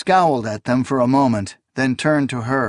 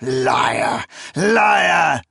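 put-on furious old priest. A narrator's reading. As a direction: hurl the word at her. Priest shouting at her.

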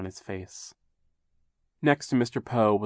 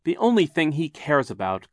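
louder, higher bitter retort; 7 semitones higher, 9 dB louder than usual. A narrator's reading. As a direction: throw the accusation out bitterly. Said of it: "Klaus said bitterly".

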